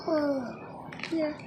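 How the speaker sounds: usual speaking voice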